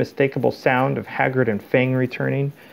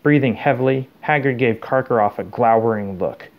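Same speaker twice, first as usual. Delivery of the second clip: ordinary delivery, nothing marked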